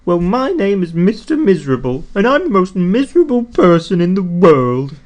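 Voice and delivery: a hammed-up miserable whine; miserable complaint. A narrator's reading. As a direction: moan it out, sorry for himself. Mister Miserable introduces himself.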